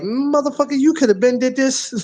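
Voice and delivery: mock exasperated outburst. Retold frustrated outburst.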